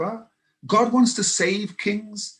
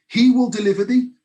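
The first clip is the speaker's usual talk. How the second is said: louder and higher, proclaiming it; loud declaration; 5 semitones higher, 7 dB louder than usual. Retold or imitated. King declaring to Daniel's God, loud and high. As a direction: ring it out like a royal decree.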